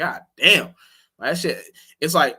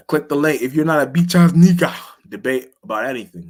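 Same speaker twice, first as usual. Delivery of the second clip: taunting; 3 semitones higher, 8 dB louder than usual. Real, not acted. Streamer daring viewers to click the debate link, louder. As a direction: goad them into the debate.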